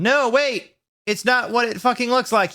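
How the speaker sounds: high, loud desperate plea; shouted. A narrator's reading. Caught boyfriend shouts after her, high and loud. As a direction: yell it out, pleading to be heard. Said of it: "he shouted".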